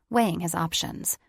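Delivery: usual speaking voice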